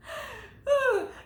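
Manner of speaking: bursts into a high squeal of a laugh. Real, not acted. Vlogger laughing at her own singing, a high cackle.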